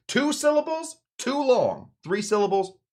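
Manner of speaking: very high, silly voice; hammed-up high-pitched approval; acted gushing buddies. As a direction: squeak it in mock delight. Buddies gush over the short name.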